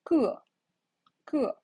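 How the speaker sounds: 个 said gè, high start falling; teacher modelling 个 loudly. Shown as a demonstration. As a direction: drop 个 sharply. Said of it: "the tone mark goes down".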